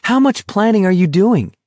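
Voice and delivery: acted heated outcry; crying out. A narrator's reading. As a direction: demand it in a high-pitched cry. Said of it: "Garrity cried".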